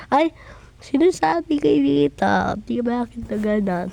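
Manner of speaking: ordinary delivery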